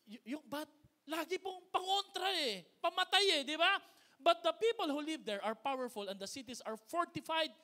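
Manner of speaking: loud, high, rising sermon climax; preaching at full force. Acted. Pastor thunders at the congregation, full-force preaching.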